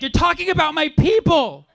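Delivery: high, loud indignant cry; outraged yell; outraged heckler. Retold or imitated. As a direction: play the offended woman yelling back. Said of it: "She's like".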